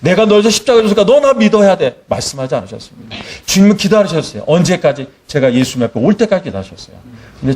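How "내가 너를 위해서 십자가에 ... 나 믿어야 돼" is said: high, insistent mock demand; coercive demand. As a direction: press them to believe, as a counterexample. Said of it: "예수님의 사랑은 강요한 적 없어요". (높은 음으로 강요하는 척하는 말투)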